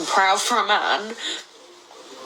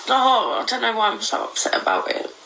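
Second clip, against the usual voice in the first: normal talking voice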